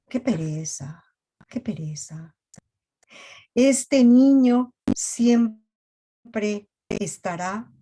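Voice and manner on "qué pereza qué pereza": whisper. Real, not acted. Sighed aside after the list.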